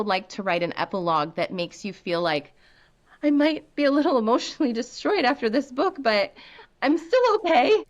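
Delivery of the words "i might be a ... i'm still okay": high-pitched voice climbing higher at the end; mock-brave, pitched well up; imagined reader reassuring themselves. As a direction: put on a high little "I'm coping" voice. Reader's shaky reassurance after book.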